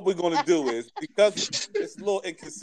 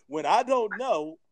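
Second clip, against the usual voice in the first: slow mock quote; slower than usual. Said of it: "He said".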